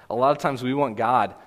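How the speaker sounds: normal talking voice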